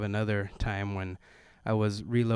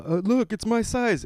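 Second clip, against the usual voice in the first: put-on higher, louder, slow kid voice; 11 semitones higher, 6 dB louder than usual. Son insisting the shoes fit, higher, slow and loud. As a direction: whine it like a stubborn kid.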